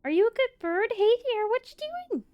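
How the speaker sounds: put-on high, sing-song baby talk to a bird; high cooing pet-parrot voice. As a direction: coo it sweetly like praising a pet bird. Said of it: "hear him on the other side of the house and be like".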